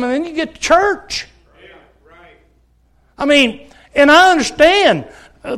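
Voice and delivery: loud, driving pulpit preaching; hard-pressing Baptist sermon voice; fervent preaching. Acted. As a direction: preach it out with full force. Preaching at full force.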